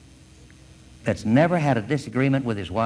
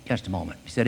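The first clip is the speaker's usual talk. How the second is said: low, gentle bear voice; hushed, reassuring tone; soft, soothing; 4 semitones lower, 4 dB quieter than usual. Acted. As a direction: speak softly and low to calm the hunter. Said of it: "he spoke in a soft, soothing voice".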